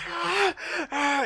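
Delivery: very high shrieking cry; shrill shriek. Acted. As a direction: let out a sudden piercing shriek. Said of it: "you hear a shriek".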